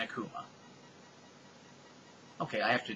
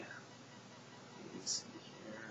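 whispering; 17 dB quieter, much slower than usual. Real, not acted. Looking up the author.

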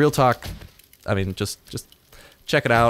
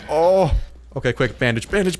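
usual speaking voice

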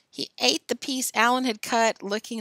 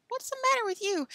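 very high, puzzled voice; puzzled question. Acted. Boys turn to groaning Tom, puzzled high question. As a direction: ask it high, baffled by him.

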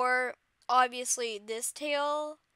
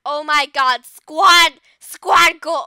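excited shout; 17 dB louder, slower than usual. Real, not acted. Excited at new item.

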